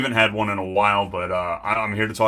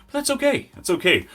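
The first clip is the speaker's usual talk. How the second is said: high chirpy reassurance. Real, not acted. Consoling himself.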